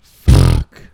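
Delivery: dropped way down low; very deep voice. Real, not acted. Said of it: "Why'd you say it like that?".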